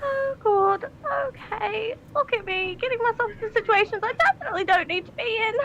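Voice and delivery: high, hushed fretting to oneself; panicked. Acted. Minx still scared of fire, panicked.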